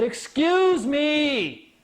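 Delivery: high, drawn-out retold call; hammed-up, emphatic demand; forceful interruption. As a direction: cut through her rant slowly and insistently. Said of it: "he had to interrupt her".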